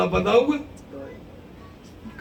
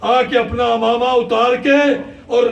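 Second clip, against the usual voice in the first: preaching at full force; 4 semitones higher, 4 dB louder than usual. Acted. Preacher narrates Abbas's anguish, loud and driving. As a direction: drive the battle story home to the crowd.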